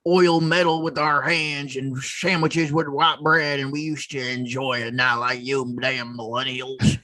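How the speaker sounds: put-on slow, grumbling old boomer; Buck Flowers voice. Buck Flowers character griping about millennials, slow old-man voice. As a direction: grouse like a cranky old-timer about prices. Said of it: "just doing that Buck Flowers voice".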